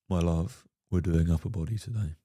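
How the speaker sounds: low, soft and steady; calm soothing boyfriend. Pretend. Boyfriend gently holding firm on upper body, low and soft. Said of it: "calm boyfriend reply".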